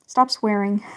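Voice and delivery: ordinary talk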